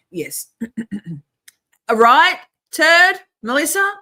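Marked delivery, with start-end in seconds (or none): 1.9-3.9 loud, high call to chat members. Real, not acted. Streamer hailing two members in chat, calling out. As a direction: call the names out, raised and drawn out.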